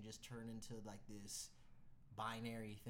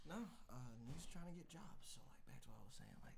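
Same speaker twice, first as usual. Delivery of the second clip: lowered volume, still voiced; hushed near-whisper; 6 dB quieter than usual. Real, not acted. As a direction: keep it down for someone on a call. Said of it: "You don't have to whisper".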